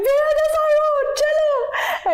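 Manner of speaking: high, wailing plea to the driver; calling to a rickshaw driver while crying, high wail; mock crying. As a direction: sob it out like a drama queen. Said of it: "I'd be like".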